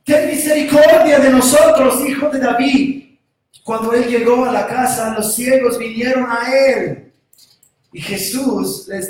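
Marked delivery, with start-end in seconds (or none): 0.0-3.2 pleading call. Acted. Blind men clamoring for mercy.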